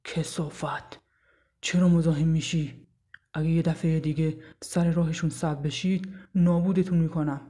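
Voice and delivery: menacing rescuer's warning, voice altered; angry threat. A narrator's reading. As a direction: threaten harassers with controlled anger. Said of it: "با خشم گفت".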